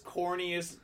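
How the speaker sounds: ordinary delivery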